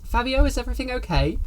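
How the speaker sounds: high, quick woman cleaner's call; concerned cleaner. Acted. Older cleaner checking on Fabio, high and concerned. Game master voices the approaching cleaner.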